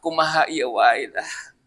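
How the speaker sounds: slow, soft Sundanese phrase. Retold or imitated. Preacher imitating gentle West Javanese talk, slow and soft. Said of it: "Lembut ngomongnya".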